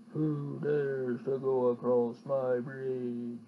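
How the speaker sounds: low, slow booming bear; deep menacing bear demanding; big bear voice. Acted. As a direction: growl it out like a great big bear. Bear speaks at bridge.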